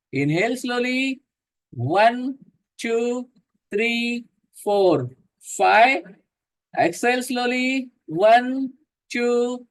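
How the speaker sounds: slow inhale and exhale cues, counted steadily; guided breathing count. Acted. Yoga teacher leading the class through slow breathing.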